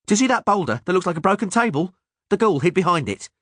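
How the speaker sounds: high, fast gnome chatter; excited gnome. A narrator's reading. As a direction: point it out eagerly. Gnome voiced.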